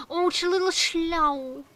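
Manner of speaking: high, bright burst of delight at finding the kitten; light up at the sight of a little cat; delighted gasp at a cat. Real, not acted. Spots a cat in the rain.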